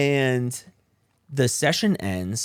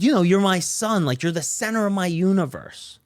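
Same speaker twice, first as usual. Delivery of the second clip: raised retold quote; 10 semitones higher, 6 dB louder than usual. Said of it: "and she said".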